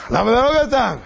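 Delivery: loud, high-pitched challenge; urgent demand. Acted. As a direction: call the question out to the crowd. Urging audience for reasons, timed. (שאלה דוחקת בקול רם)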